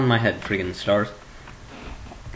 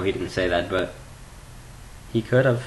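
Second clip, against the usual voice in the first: ordinary delivery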